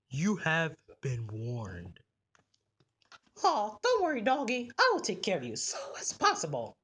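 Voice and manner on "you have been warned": very low, slow, soft doom voice; creepy manager's parting warning; ominous warning. Acted. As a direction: warn them like a movie villain, slowly.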